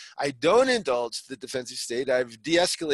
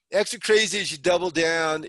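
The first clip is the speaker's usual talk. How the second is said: ordinary talk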